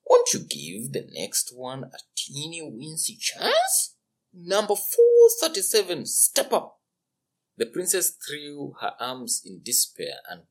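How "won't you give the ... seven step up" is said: coaxing royal father, then announcing; pleading king. Acted. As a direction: wheedle his daughter, then call the next. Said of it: "the king plead".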